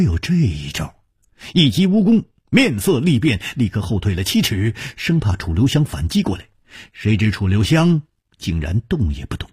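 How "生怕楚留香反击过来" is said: ordinary delivery, nothing marked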